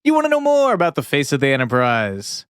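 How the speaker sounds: drawn-out game show host call; booming prize announcer. Shown as a demonstration. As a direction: stretch the name like a host. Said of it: "in your game show announcer voice".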